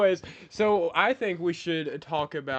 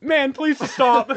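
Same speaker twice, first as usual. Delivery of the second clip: dramatic pleading; 9 semitones higher, 10 dB louder, much slower than usual. Acted.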